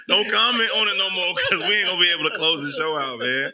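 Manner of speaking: high laugh spilling into fast words. Real, not acted. Host begging the chat to stop, laughing high. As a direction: laugh then rush out the plea, high. Laughing while closing the show.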